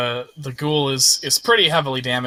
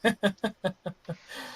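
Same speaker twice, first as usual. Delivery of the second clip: soft, high mocking chuckle; light sinister tittering; villain's laugh; 6 semitones higher, 8 dB quieter than usual. Unseen enemy laughing at the prayer, soft high chuckle. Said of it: "you hear someone laughing".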